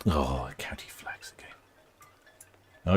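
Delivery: hushed unvoiced aside; whisper. Real, not acted. Vlogger whispering an aside to herself. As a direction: breathe it out under your breath.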